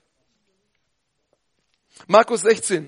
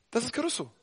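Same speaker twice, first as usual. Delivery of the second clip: sudden quiet; 13 dB quieter, faster than usual. Shown as a demonstration. Preacher contrasting after his shout, suddenly soft. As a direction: drop to a hush after the yell.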